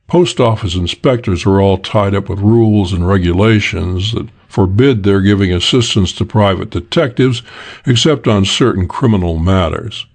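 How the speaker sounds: usual speaking voice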